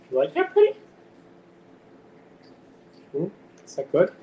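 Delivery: pet talk. Real, not acted. Cooing at cat over squash.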